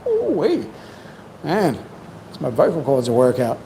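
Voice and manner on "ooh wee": high whoop. Real, not acted. Whoops after long stream.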